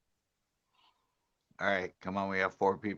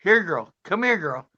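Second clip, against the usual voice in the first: high, loud coaxing call; calling a lost pet; 8 semitones higher, 6 dB louder than usual. Real, not acted. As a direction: coax the dog over from afar. Calling the dog.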